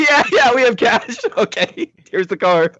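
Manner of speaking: breezy clerk, chuckling along; laughing mock clerk. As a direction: clerk shrugs off the license, laughing and high. Voicing the clerk handing over the car.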